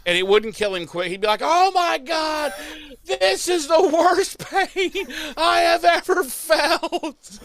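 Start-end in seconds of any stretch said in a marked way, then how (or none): 1.4-7.4 high, slow, pained wail; injured man wailing in pain, much higher; mock agony. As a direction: ham up the pain. Xander impaled in agony.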